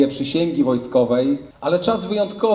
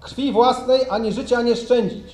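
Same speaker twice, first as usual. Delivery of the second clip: formal oath reading; 5 semitones higher than usual. Real, not acted. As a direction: dictate the oath solemnly for troops. Officer reads oath for repeating. (uroczyste odczytywanie przysięgi)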